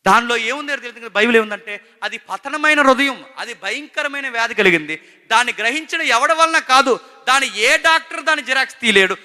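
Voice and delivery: high, loud preaching to the church; fervent sermon voice; full-force preaching. Acted. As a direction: drive each claim home from the pulpit. Preacher raising voice to congregation.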